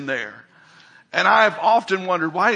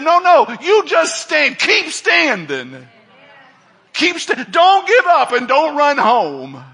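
loud, higher, pleading shout to stand firm; the Lord urging; 8 semitones higher, 5 dB louder than usual. Retold or imitated. The Lord shouting to a struggling believer, high urgent shout. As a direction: cry out the command to keep standing, louder. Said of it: "but he said".

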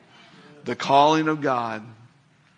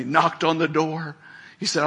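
ordinary talk

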